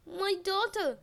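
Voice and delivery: a touch of sobbing grief. Acted. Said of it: "she sobbed".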